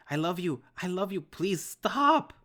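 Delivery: loud high sobbing plea; desperate scream of love and pleading; frantic begging. Acted. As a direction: throw everything into begging him to stop. Begging dad with gun.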